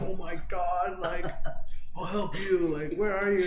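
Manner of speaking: high, concerned helper voice; worried caller voice. Retold or imitated. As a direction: sound eager to help a lost old lady. Voicing the worried phone operator.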